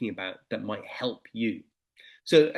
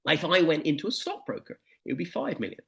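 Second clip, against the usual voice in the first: usual speaking voice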